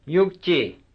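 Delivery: ordinary delivery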